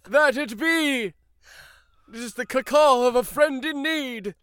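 high, loud hammy pirate; pirate captain. Acted. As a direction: boast like a grand pirate captain.

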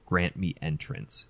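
faint, slow, seductive whisper; sultry whisper. Retold or imitated. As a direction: breathe it like a temptress. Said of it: "really faint, really sultry voice".

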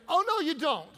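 high, loud sassy 'Oh, no, you don't'; sassy attitude. Shown as a demonstration. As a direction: really sell the attitude. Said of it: "We can do better".

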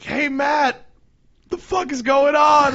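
loud, high shout across the street; a high, hollering greeting; fan calling out. Retold or imitated. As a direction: yell it like a fan spotting him.

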